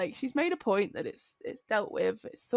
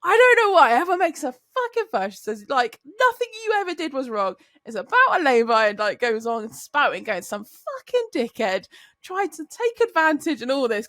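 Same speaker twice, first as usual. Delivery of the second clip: louder, higher indignant rant; imitating Kat ranting; 4 semitones higher, 7 dB louder than usual. Coworker ranting in Chloe's defence, loud indignation. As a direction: go full spiel, fired up. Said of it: "she said".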